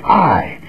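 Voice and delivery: I said slowly and weighted; emphatic I. Shown as a demonstration. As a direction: stress on I.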